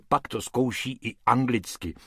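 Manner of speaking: ordinary delivery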